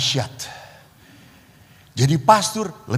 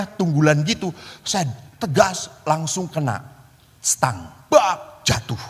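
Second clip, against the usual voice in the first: usual speaking voice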